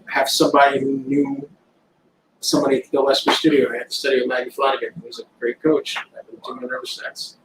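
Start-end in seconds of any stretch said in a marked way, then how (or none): none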